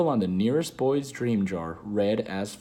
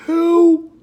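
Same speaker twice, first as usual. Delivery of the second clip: much higher, louder shocked yelps; shocked squeak; 13 dB louder than usual. Retold or imitated. Father stunned on the phone, yelping in shock. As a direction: leap up in pitch in sudden alarm. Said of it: "his voice goes all funny".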